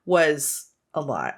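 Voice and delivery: ordinary delivery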